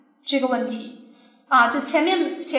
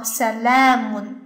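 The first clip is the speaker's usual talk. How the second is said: salamun with la drawn out; stress on long LA; 3 semitones lower, much slower than usual. Shown as a demonstration. Teacher reading the example, long la stressed. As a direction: lean slowly on the la in salamun. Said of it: "那么重音就在它身上".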